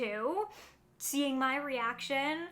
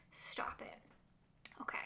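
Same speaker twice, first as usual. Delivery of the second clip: much softer, whispered stop it; overwhelmed whisper; 15 dB quieter than usual. Real, not acted. Vlogger overwhelmed by picks, whispering. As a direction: whisper it, overcome with delight. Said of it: "I get way too excited about this".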